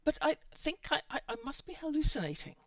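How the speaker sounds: high, loud, halting babble; panicked. A narrator's reading. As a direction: stammer out a frantic explanation. Desperate explanation under threat.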